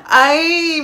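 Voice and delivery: giddy fan squeal; high squeal laugh. Real, not acted. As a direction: burst into a giddy shriek. Fangirling over the teaser.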